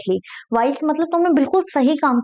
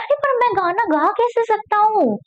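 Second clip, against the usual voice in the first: higher, pleading little bird voice; the bird protests helplessly; 8 semitones higher than usual. Acted. Bird's line retold in Hindi. (ऊँची आवाज़ में बेबस चिड़िया)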